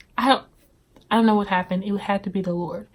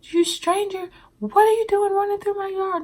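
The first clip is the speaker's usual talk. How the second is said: imitating a screaming lady; 12 semitones higher than usual. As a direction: play the angry yard lady, high and shrill-pitched. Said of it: "she was screaming at me like".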